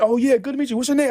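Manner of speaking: high friendly mock greeting; imitating the fan. Fan saying goodbye on the train, high eager greeting.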